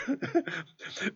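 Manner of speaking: high burst of laughter. A narrator's reading. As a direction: laugh at the absurd idea. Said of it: "Sidwell lachte lange". (hohes Lachen)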